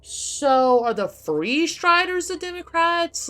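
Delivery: high, loud, slow disbelieving ask; can't believe the parallel; real amused disbelief; incredulous question. Real, not acted. Incredulous question about factions.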